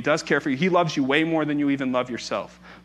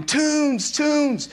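high, drawn-out mock toddler holler; imitated toddler yell; 13 semitones higher than usual. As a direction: mimic a small boy yelling for cartoons. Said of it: "Bronson's yelling".

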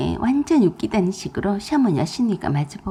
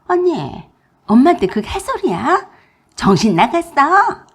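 angry tirade; 6 semitones higher, 4 dB louder than usual. A narrator's reading. Sister-in-law lashes out at the narrator in a high, loud rage. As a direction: lash out at her sister-in-law. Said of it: "제게 막 퍼부어댔어요".

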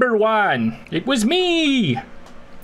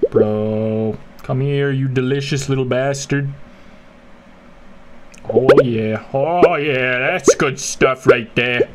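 deep gloating voice; 10 semitones lower than usual. Pretend. Gloating at the snake he eats.

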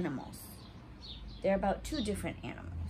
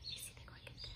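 hushed whispered aside; 20 dB quieter, much faster than usual. Real, not acted. Showing the cricket to children, whispered. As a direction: whisper it so the cricket won't hear.

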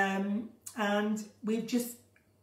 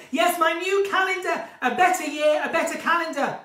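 excited plug; 8 semitones higher, 8 dB louder than usual. Real, not acted. Plugging the calendar.

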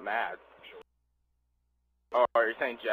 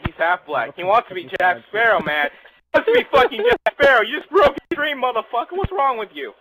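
loud, much higher hammed-up rant; mock outrage; 9 semitones higher, 10 dB louder than usual. Gamer scolds friend in hammed-up fake outrage. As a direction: rant at him in fake indignation.

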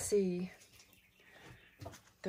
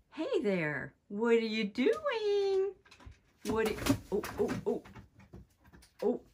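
cooing pet voice; 4 semitones higher than usual. Real, not acted. Talking to a cat in attic.

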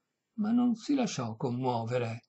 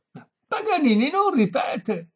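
high, slow, loud protest; exasperated shout; 11 semitones higher, 8 dB louder than usual. A narrator's reading. Violinist to deaf old lady, high exasperated shout. Violinist yells refusal again.

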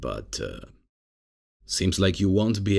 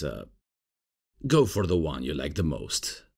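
ordinary talk, nothing marked

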